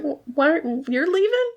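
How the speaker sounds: high-pitched, stammering disbelief; a light imitation of hurt surprise; mock dismay. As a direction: rise in shock as he's abandoned. Steed dismayed at Ed leaving.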